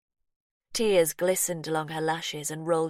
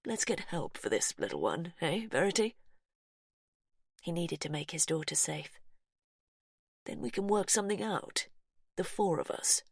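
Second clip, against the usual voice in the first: softer, coaxing and steady; calm reasoning; 4 dB quieter than usual. Acted. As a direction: talk her down gently. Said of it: "try for calm reasoning".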